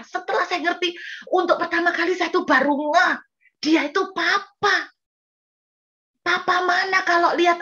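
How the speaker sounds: high, insistent sermon voice; fervent preaching. Acted. Preacher asking the congregation which father would not care, fervent. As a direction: press each rhetorical question.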